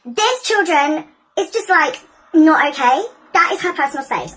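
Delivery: high, loud, emphatic admonishing; teacherly disapproval. Acted. Teacher tells children the kiss is wrong, emphatically disapproving. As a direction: lay down the rule to a class.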